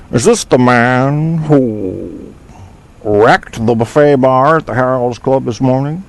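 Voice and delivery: slow, drawn-out movie character line; movie quote impression. Host quotes a film line for a chat room quiz, slow character voice. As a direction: drag out the first words like the film character. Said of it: "name what movie that come".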